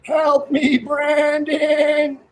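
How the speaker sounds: high, drawn-out plea; mock call for help. Joking with friends, mock high-pitched cry for help. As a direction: pretend to beg for rescue.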